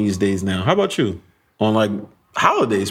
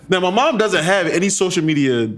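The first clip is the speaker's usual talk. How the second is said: normal talking voice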